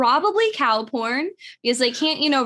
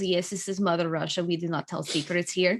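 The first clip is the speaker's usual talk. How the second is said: lightly put-on Russian accent, lower and softer; a hint of a stern Russian bureaucrat, pitched low; mock Russian official; 5 semitones lower, 5 dB quieter than usual. Podcaster voicing Russian officials who keep secrets, in a mock Russian accent.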